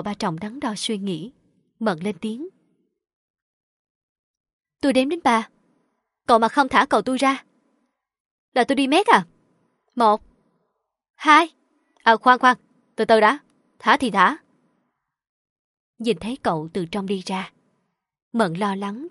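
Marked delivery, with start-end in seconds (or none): 4.8-11.5 loud. Acted. Girl threatens to count to three. 12.0-14.4 hurried. Acted. Man hastily gives in.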